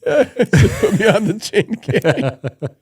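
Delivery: high, loud plea drawn out with laughter; cracking up while quoting the kid; helplessly amused mock plea; laughing retold plea. Laughs through the kid's plea.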